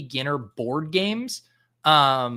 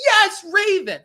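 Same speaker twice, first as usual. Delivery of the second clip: put-on much higher, louder gleeful little girl's cheer; imitating daughter cheering; 8 dB louder, slower than usual. Mimicking his daughter cheering the raven in a high, loud squeal. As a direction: squeal it like a delighted small kid. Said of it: "she'll be like".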